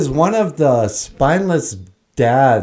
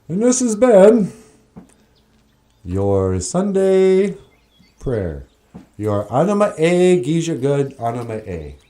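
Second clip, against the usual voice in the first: announcer voice; 3 semitones higher than usual. Acted. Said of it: "show sign-off announcement".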